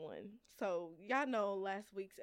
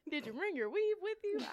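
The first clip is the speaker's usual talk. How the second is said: higher, smug mock coworker voice; 9 semitones higher than usual. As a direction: parody his proud little joke. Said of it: "proud to say it, laughing".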